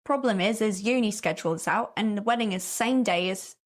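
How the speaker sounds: put-on English accent; playful attempt at an English accent. Shown as a demonstration. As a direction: read it in a mock English accent. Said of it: "I'm sorry, I gotta do an accent".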